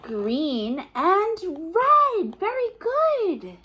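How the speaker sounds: drawn-out, high praise; slow delighted praise. Acted. Reader slowly praises the child's answer. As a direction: savor each word proudly.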